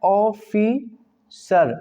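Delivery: ऑफिसर stretched very slowly, first sound long; teacher drawing out ऑफिसर. Shown as a demonstration. Teacher shows students the opening vowel, very slow. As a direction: draw out ऑफिसर slowly so the vowel start is heard. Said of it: "अ आ रहा है".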